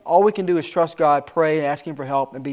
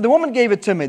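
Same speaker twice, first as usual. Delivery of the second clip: Adam blaming; 6 semitones higher, 5 dB louder, faster than usual. Acted. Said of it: "The man said".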